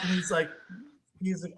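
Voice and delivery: ordinary delivery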